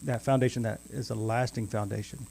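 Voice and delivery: ordinary delivery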